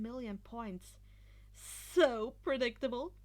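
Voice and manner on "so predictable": slow, louder scornful remark; grimacing. A narrator's reading. Izuku scoffing at the other teams, slow and scornful. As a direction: sneer at their obviousness. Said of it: "Izuku grimaced".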